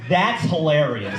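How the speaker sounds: slow, mock-deliberate praise; enunciated. Audience member's reaction to a joke, imitated with over-enunciation. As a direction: mimic him, spelling out each word carefully. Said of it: "he enunciated".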